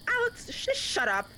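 high, sharp shut-down; wife snapping at her dim lover; annoyed snap. Acted. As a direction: cut him off irritably. Snapping at accomplice.

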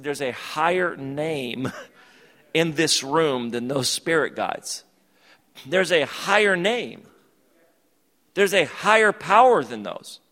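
rising preacher's proclamation, slow then loud; preaching. Acted. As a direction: proclaim it like a pastor building up. Preaching declaration.

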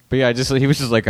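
normal talking voice

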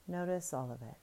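normal talking voice

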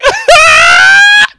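shrieking laugh-cry, very high and loud; laugh-cry shout. Retold or imitated. Comedian reenacting her shocked reaction, a loud shrieking laugh. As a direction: let out a horrified laughing scream. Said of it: "that's what it sounded like".

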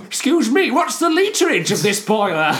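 high, put-on inquisitive voice; nerdy tour visitor. Factory visitor asking about boilers, high mocking voice. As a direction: pipe up like an over-keen visitor.

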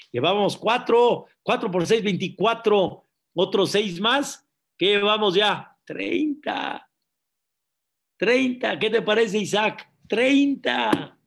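loud, excited tally of the count. Real, not acted. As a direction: call out the count with rising excitement. Cuenta emocionada de embarazos. (conteo emocionado y fuerte)

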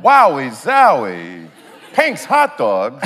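drawn-out beatnik wonder; cool beatnik. Retold or imitated. Mocking LA tourist.

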